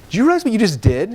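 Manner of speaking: high, loud, astonished question; amazed dad. Retold or imitated. Dad to son after the teleseminar, high and amazed. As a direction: make him hear what he just did.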